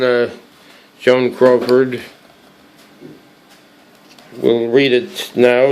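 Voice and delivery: usual speaking voice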